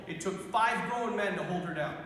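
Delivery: ordinary talk, nothing marked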